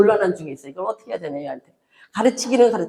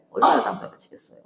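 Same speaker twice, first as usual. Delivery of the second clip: breathy aside; 2 semitones higher, 6 dB quieter than usual. Real, not acted. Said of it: "repeated in whisper".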